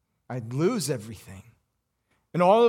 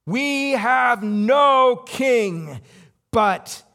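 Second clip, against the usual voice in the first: slow, drawn-out, raised cry; crowd declaration; 7 semitones higher, 6 dB louder, much slower than usual. Acted. The crowd rejecting their king, slow and loud. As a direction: drag out every word like a mob's cry. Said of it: "And then they said it".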